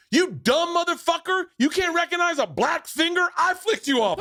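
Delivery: high, cocky mocking voice, chuckling at the end; defiant taunt. Quate taunting the furious driver, high and cocky. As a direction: taunt him, proud of the insult.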